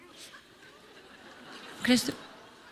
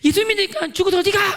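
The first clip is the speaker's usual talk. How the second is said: high, loud demanding yell; shouted interrogation; 8 semitones higher, 6 dB louder than usual. Retold or imitated. Grilling a believer about the afterlife, acted angry shout. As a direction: push the question out hard.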